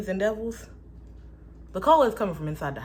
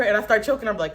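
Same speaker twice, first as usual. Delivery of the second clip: ordinary delivery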